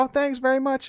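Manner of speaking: high, grateful grandma. Retold or imitated. Grandma thanking grandkids for groceries, high and sweet. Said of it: "she's like".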